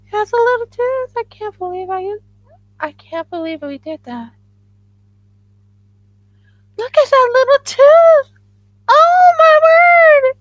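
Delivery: very high, loud excited squeal. Real, not acted. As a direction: squeal with real delight over the tiny tooth. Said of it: "I go into a very high pitch when I got really excited".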